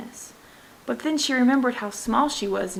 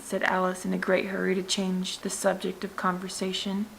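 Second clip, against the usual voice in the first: usual speaking voice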